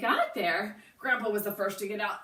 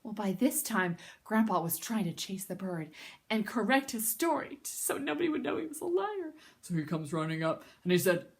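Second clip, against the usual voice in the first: plain reading voice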